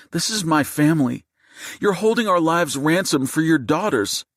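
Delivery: high-pitched indignant fury; heated retort. A narrator's reading. Mother tells gunman this is her business, furious. Wife argues back at gunman.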